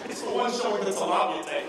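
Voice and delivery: ordinary delivery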